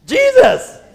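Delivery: a high, loud cry of the name; exclaimed answer. Acted. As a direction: call it out in triumph. Pastor answers his own question.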